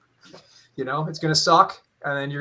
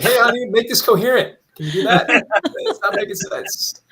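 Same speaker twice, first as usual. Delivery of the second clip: laughing plea; 8 semitones higher, 8 dB louder than usual. Retold or imitated. Asking wife to fix writing.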